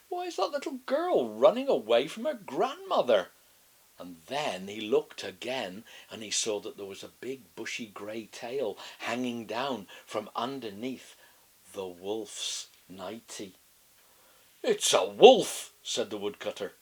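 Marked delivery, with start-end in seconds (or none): 0.1-3.3 higher, louder wondering; puzzled woodcutter. A narrator's reading. Woodcutter watching girl run, lightly voiced puzzlement. 14.6-15.7 louder, slow realization; loud exclamation. A narrator's reading. Woodcutter spotting wolf's tail, slow loud exclamation.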